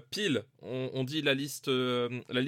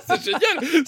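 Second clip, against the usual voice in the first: laughing delight; 8 dB louder, much slower than usual. Real, not acted. Laughing delight over a joke.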